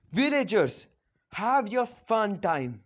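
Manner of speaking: high, loud announcement to a crowd; calling out. Retold or imitated. They hand Naruto to the villagers, loud acted call.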